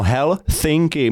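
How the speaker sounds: Helsinki slowly, with s as a th; lisped Helsinki. Shown as a demonstration. Teacher modelling the th sound hidden inside Helsinki. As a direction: stretch out Helsinki with a lisp. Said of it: "teď řekni Helsinki".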